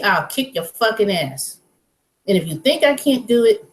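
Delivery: ordinary talk, nothing marked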